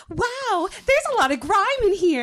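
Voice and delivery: high, bright chirpy guide; tour guide Barbie voice. Acted. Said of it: "and mine is".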